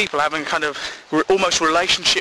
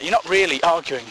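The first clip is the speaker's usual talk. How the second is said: ordinary talk, nothing marked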